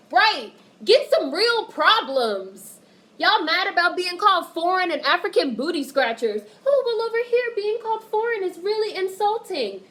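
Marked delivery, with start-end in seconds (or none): 0.1-5.5 loud retort. Real, not acted. Retorting loudly to critics. 6.6-9.8 high, prissy mock voice; mocking critic voice. Mocking critics online in a high put-on voice.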